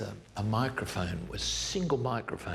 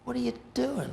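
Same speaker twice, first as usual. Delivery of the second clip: exasperated interruption. Retold or imitated. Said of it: "I stopped and said".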